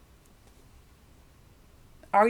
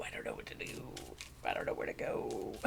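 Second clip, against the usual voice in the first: mock whispered dithering; 16 dB quieter, faster than usual. Mock lost querent whispering.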